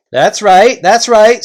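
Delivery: loud, high chieftain calling a halt; gruff invisible chief. Acted. Invisible chief halting the Narnians, loud and high. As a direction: bark the warning from thin air.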